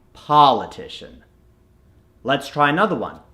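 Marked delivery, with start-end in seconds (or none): 0.1-1.2 politician with a long open "ah" first; American accent. Shown as a demonstration. Coach shows the converted word, American accent. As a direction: say "politician" the American way, as "pah-litician". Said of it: "it's gonna become".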